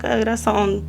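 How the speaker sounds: ordinary talk, nothing marked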